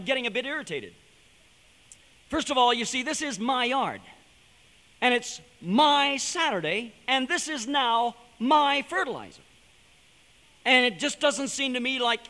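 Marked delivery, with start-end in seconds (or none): 3.0-9.4 "my" hit hard and stretched out each time, a bit higher and slower; stressed my. Retold or imitated. Preacher to congregation, mock indignant, pressing hard on "my". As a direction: lean slow and heavy on "my" in "this is my yard". Insisting on his ownership.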